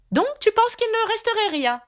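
very high, querying queen; challenging Red Queen. A narrator's reading. Red Queen challenging Alice's answer, very high queenly voice. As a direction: question her from high up in the voice.

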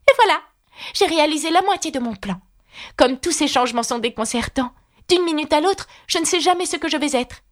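put-on high little-girl voice thinking aloud; high-voiced girl taking stock of her plan; Alice musing aloud. A narrator's reading. Alice talks to herself.

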